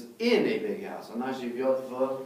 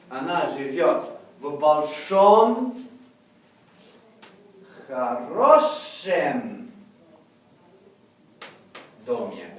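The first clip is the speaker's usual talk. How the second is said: drawn out, louder model sentence; slow modelled sentence; 7 dB louder, much slower than usual. Shown as a demonstration. Teacher models the locative endings, slow and clear. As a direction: stretch хорошем out very slowly. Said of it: "The stress is on the stem".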